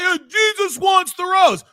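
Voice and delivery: host mocking the guest's outburst; mocking impression. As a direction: parody the angry preacher. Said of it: "Why are you getting so fucking angry?".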